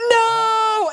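high, drawn-out "no" in protest; a hammed-up wail of denial; mock outburst. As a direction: blurt it high and stretch it out. Acted denial in retold quarrel.

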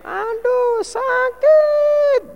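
very high, whiny cry of hurt; high pretend moan of sickness; mock whine of pain. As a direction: whimper like someone putting on pain. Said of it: "gitu loh".

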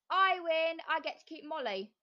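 high, loud gloating witch. A narrator's reading. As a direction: crow over the win. Witch gloats over winning.

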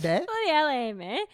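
hint of a Lancashire mum reading a text; mum's Lancashire voice. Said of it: "you're making her sound like one of them people".

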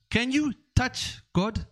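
put-on high, slow, quizzing question in a Nigerian accent; Nigerian professor voice. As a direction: lecture the child, drawing it out. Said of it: "the guy goes".

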